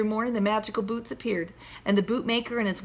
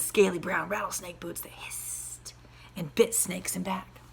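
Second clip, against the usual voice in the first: softer, unvoiced whisper; hushed hissing; 4 semitones lower, 8 dB quieter than usual. A narrator's reading. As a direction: hiss like a snake. Hissing snake boots.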